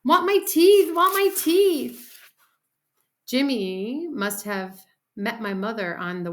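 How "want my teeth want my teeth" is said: high, loud, drawn-out wail; bawling demand; toddler screaming. A narrator's reading. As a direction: scream it like a small child's tantrum. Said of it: "Fudge started to scream".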